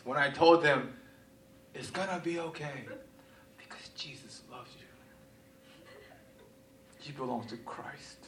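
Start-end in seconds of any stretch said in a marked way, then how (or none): 1.7-8.2 much softer, near-whispered reassurance; tender whisper, holding back tears; tearful comforting. Retold or imitated. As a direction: hush it out like you're about to cry. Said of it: "And I'm, like, tearing up".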